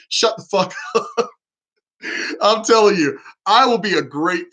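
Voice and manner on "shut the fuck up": higher voice breaking into a laugh; laughing mock rebuke. Would-be president telling Obama to shut up, laughing. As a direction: end the pretend telling-off in a chuckle.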